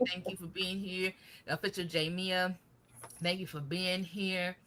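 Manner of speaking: ordinary talk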